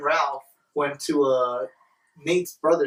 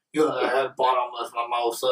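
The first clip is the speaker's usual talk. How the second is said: put-on drunk voice; 3 semitones lower than usual.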